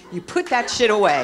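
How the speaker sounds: loud, forceful telling-off; defiant command. Acted. Women with their voice telling a man, defiant loud command. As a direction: order him off at full volume.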